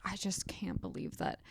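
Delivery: hushed whisper of mom in the kitchen. Retold or imitated. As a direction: breathe it out like a secret.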